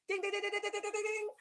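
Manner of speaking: high, soft rapid string of dings; tiny high-pitched bell chime, rattled off; imitated dinging. Vlogger marks the ring upgrade, imitated dinging.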